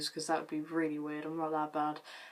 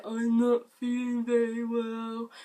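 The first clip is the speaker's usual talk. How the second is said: slow, higher and louder mock-sick voice; pulling a face; 5 semitones higher, 6 dB louder, slower than usual. Child on a dog lead playing sick for the family. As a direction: ham up a poorly child, dragging it out. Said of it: "told me to pull a face and say".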